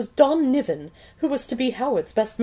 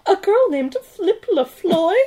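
incredulous heroine; 7 semitones higher, 4 dB louder, slower than usual. Acted.

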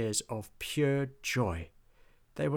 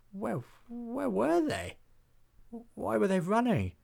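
bewildered soldiers; 11 semitones higher than usual. A narrator's reading. Dazed soldiers waking from a spell.

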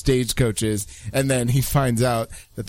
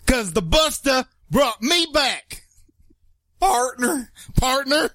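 high, slow hammed-up cowboy twang; Vin Diesel cowboy twang; 10 semitones higher, slower than usual. Host trying Vin Diesel as a cowboy in a mock twang. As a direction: Toretto stretching into a cowboy twang, slowly. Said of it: "trying to do it in a cowboy twang".

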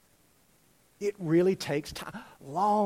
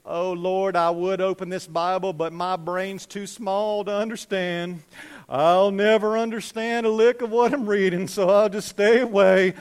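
mock-humble, drawn-out complaint; false humility; 2 semitones higher, 6 dB louder, slower than usual. Preacher mimics a reader begging off the Bible, falsely humble. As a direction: play up helpless self-pity, slowly growing louder. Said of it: "kind of false humility".

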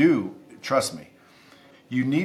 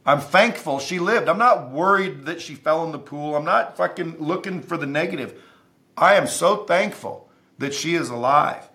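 emphatic; 6 semitones higher, 3 dB louder than usual. Real, not acted. Passionate point about gratitude.

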